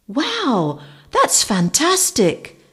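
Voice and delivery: delighted wow. Acted. Cave woman amazed.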